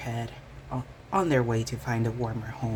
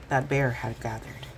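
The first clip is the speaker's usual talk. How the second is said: usual speaking voice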